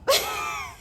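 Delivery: high shrieking laugh. Real, not acted. Reveals declining the job.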